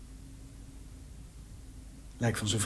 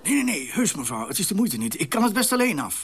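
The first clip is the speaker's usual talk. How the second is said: flustered refusal; 8 semitones higher, 4 dB louder than usual. Acted. Fending off neighbour in panic.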